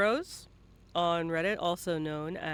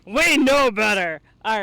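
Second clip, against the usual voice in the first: mock scolding; 4 semitones higher, 11 dB louder than usual. Teasing guest after denial.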